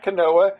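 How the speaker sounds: quinoa said wrong as kuh-NO-uh; mispronounced quinoa. Retold or imitated. Friends mocking his mistake, quinoa mispronounced kuh-NO-uh. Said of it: "it's not quinoa".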